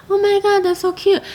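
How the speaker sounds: high squealing gush over a cute puppy; gushing excitement. Retold or imitated. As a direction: squeal it like you just saw the cutest puppy.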